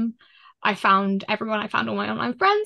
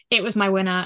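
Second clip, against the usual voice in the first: ordinary talk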